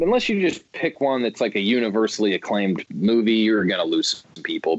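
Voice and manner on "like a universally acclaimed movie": ordinary talk, nothing marked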